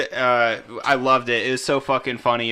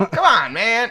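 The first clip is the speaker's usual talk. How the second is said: high, loud mock Biden catchphrase with a laugh; hammed-up old-politician grumble; Joe Biden impression; 11 semitones higher, 5 dB louder than usual. Friends mimic the Biden soundbite, hammed-up high Biden voice. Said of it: "Was that Biden?".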